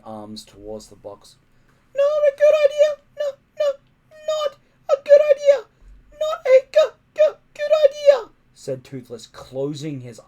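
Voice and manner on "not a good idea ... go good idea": very high, squeaky fretting dragon. Acted. Toothless the little dragon fretting as the lid lifts, squeaky. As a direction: panic like a tiny dragon, nearly crying.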